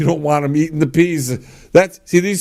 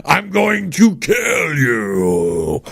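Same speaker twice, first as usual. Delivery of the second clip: slow, higher mock-threatening growl; mock threat; 5 semitones higher, much slower than usual. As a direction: threaten the kid to scare him. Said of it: "threaten them a little bit".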